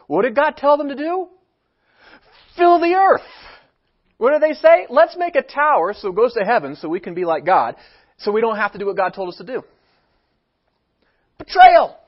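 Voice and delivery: loud, high preaching cry. Real, not acted. Preacher crying out to the congregation, loud and high. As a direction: hammer it home to the congregation.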